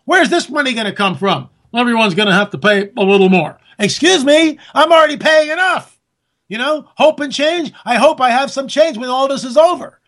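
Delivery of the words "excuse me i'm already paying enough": high-pitched, mock-affronted objection; indignant protest. Host objecting to everyone paying a little more, indignant.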